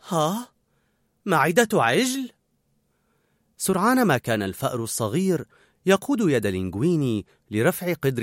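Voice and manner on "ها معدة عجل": slow, higher-pitched confused question; flustered. A narrator's reading. Novice cook hearing the ingredient, flustered asking. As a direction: echo it slowly, at a loss. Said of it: "كان لينغويني مضطربا وتساءل".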